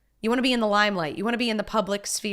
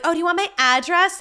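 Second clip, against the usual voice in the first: high-pitched mock offer, a bit louder; rude mocking quote; 7 semitones higher, 4 dB louder than usual. Commentator mimicking the star's reply to fans, mockingly rude. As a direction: imitate a rude comeback, pitched up. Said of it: "It's so rude to be like".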